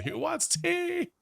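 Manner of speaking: soaring falsetto sass, drawn out slowly; sassy. Pretend. Offering tea to the shop's customers, sassy falsetto. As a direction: sing it out high, full of sass. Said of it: "are so sassy".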